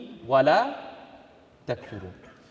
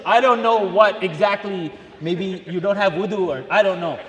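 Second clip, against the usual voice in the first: joking shout; 5 dB louder than usual. Real, not acted. Teasing the silent brothers.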